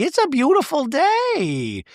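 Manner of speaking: mock dreamy; hammed-up cheerful Gina; Gina impression. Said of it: "It's like".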